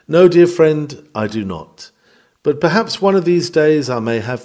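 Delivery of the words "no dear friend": usual speaking voice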